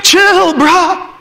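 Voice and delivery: "chill, bruh" höher, leicht lachend hingesagt; lazy teen voice. Retold or imitated. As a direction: den lässigen Jugendlichen höher nachmachen. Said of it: "Just chill, bruh".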